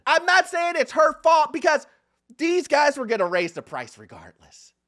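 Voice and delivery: ordinary talk, nothing marked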